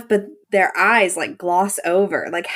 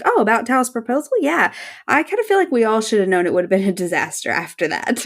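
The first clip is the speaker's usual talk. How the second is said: rattled off fast and breezy; fast, airy deflection; quick rehearsed deflection; 2 semitones higher, faster than usual. Retold or imitated. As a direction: brush it off quickly, like a ready line. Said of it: "She's like".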